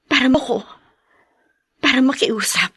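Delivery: hushed whisper, barely voiced; whispered plea. Wife quietly begging the mistress, whispered. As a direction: beg softly, almost ashamed.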